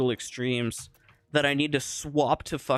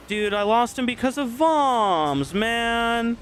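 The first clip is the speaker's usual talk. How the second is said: much higher, loud frustrated yell; real angry outburst; 10 semitones higher, 5 dB louder, slower than usual. Real, not acted. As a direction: blow up at the game. Raging after losing kill.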